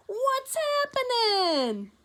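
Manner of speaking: loud, high, slow hearty bellow; loud hearty greeting. Acted. Tacky booms his greeting at his companions, loud and high. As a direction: stretch it out, booming and high. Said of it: "a loud".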